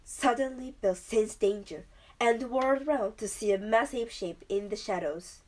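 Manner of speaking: usual speaking voice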